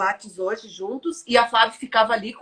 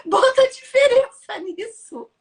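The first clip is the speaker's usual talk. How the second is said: excited outburst; 12 semitones higher, 6 dB louder, slower than usual. Retold or imitated. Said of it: "E ele, tipo".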